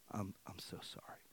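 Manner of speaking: very soft, imitated sheepish apology; hushed, contrite quote; meek apology. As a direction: drop to a near-murmur, as someone retelling a humbled apology. Voices the pharmacist's meek apology.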